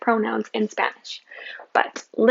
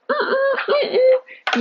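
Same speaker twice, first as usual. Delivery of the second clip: disapproving hum. Acted. Teacher rejecting wrong answer.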